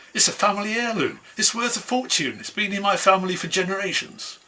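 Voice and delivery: high, pleading protest; protesting woman. Acted. Woman protests to the mouse, high and pleading. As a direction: raise the voice high in dismay.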